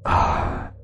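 soft, breath-only sigh; heavy sigh. Acted. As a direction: let out a long, quiet sigh. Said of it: "Thở dài một tiếng".

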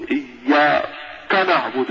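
overcareful, forced إياك نعبد; overstressed letters. Retold or imitated. Doctor mimics readers afraid of a letter, overstressed إياك. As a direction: press too hard on the sounds of إياك. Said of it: "لما يخاف من حرف معين تجده يشدد عليه".

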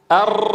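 أر with a long rolled trill of the tongue tip. Shown as a demonstration. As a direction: let the tongue tip rattle on أر. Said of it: "أولد راءات".

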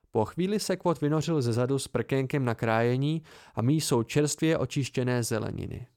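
usual speaking voice

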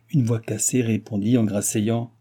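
usual speaking voice

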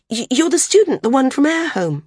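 high, loud and eager; excited recognition. Acted. Maris places the young man from Air Home, high and excited.

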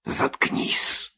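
hissed from the side of the mouth; low hissed order, lips barely moving; out of the corner of the mouth. A narrator's reading. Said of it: "краем рта прорычала".

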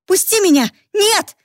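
high, loud panicked cries; screaming for help. Acted. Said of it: "закричала она".

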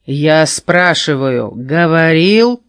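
slow, loud demanding question; angry. A narrator's reading. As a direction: drag it out, glaring. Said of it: "злобно заворочил глазами Рогонос".

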